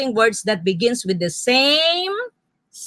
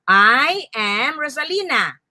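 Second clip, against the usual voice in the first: "I am Rosalina" slowly, each word its own beat; 2 semitones higher, slower than usual. Shown as a demonstration. Teacher stamping out words for learners, "I am Rosalina" slow and separated. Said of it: "Okay, stamp".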